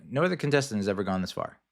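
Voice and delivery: "No other contestant has ever gone this far" rattled off fast, with no weight on any word; rushed, no emphasis. Retold or imitated. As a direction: throw the big line away at speed, like a throwaway. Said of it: "didn't put enough emphasis on the right syllable".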